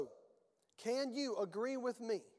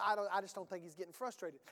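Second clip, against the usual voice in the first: usual speaking voice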